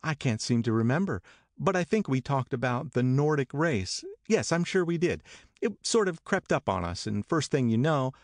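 high, airy evasive Daisy; Daisy dodging Tom's question, high and airy. A narrator's reading.

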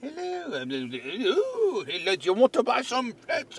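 high, silly British hello; fake British accent. Boss pitching flex to colleague, high fake British accent. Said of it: "the accent's, it's not even that".

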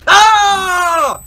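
loud, high panicked scream; screaming in fear. Real, not acted. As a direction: shriek as he grabs you. Caught by enemy in game.

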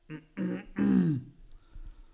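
clears throat hard; rough real throat clear. Real, not acted. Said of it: "Passerà mai sta tosse?".

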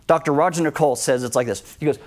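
ordinary delivery

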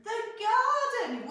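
slow, bright praising repeat; warm echo. Shown as a demonstration. Teacher praising child's garden answer, slow and warm. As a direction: echo her answer slowly with delight.

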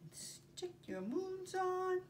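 plain reading voice

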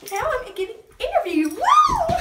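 high, loud cheering woo; excited squeal. Acted. Cheerleader celebrates her interview, loud excited squeal. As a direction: scream with pure joy.

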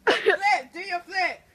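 laughing, high excited yell; excited shouting. Real, not acted. Cheering friend on, laughing.

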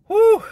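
loud high whew. Real, not acted. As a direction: blow out a big whew. Exhale before pep talk.

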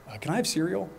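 a hint of a five-year-old asking sweetly; little daughter's voice. Small daughter asks for cereal.

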